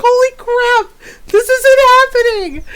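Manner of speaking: high, loud mock horror through laughter; mock panic. Imitating the daughter mid-fall, high, loud mock panic. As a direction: squeal it like she's about to fall. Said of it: "her facial expression where she's just like".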